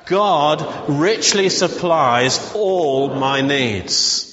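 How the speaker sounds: very slow, heavy on richly; slow declaration. Shown as a demonstration. Pastor leading the church in a slow declaration. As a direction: declare it word by word with the room. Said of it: "So let's just say that".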